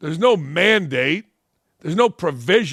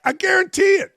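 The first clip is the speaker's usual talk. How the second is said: shouting; 12 semitones higher than usual. Real, not acted. Ranting about locker rooms, he shouts his certainty. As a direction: yell it out, fully fired up. Heated rant peaks.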